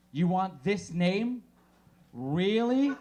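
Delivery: mock skeptical hospital clerk; judgmental nurse. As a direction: judge the parents' choice. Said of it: "job in the hospital to go".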